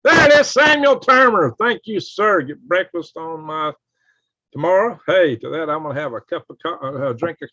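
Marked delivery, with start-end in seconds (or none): 0.0-2.4 thrilled, much higher and louder name call; excited shout-out. Real, not acted. Streamer cheering a new super chat donor, excited loud shout. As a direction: belt the donor's name out with glee.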